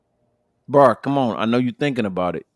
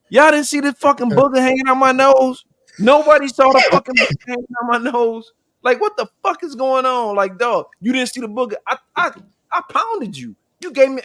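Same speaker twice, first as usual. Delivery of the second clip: ranting at coworkers; 10 semitones higher than usual. Retold or imitated. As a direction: go off on them, higher in pitch. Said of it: "I went slap fucking crazy on motherfuckers".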